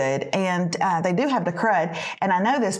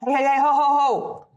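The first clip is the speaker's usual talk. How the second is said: mock announcing call; 3 semitones higher than usual. Interrupting family at the door, sing-song mock call. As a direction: breezily announce yourself at the door.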